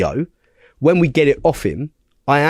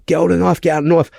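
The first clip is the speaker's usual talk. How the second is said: retold urgent shout; 5 semitones higher, much faster than usual. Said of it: "when I'm shouting".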